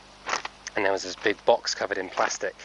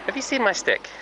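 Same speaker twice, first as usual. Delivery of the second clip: playful; 12 semitones higher than usual. Pretend. Playful voice to horse.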